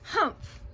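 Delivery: scornful humph. A narrator's reading. Kangaroo scoffing at the elephant, scornful humph. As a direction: let out a disapproving humph. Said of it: "Humped a voice".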